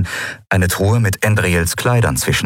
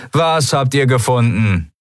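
mildly irritated call, pitched up; annoyed shout back; 6 semitones higher than usual. A narrator's reading. Pirate answering the roaring Skria, annoyed. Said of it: "rief jemand genervt".

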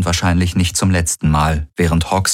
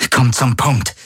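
demanding; 6 semitones higher than usual. A narrator's reading. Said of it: "hatte Kirai gefordert".

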